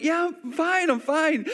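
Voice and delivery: high, pained "I'm fine", acted; strained reassurance. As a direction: insist you're okay while hiding pain. Hurt dad reassuring his scared daughter.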